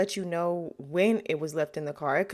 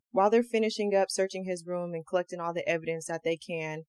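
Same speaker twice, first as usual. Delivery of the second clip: usual speaking voice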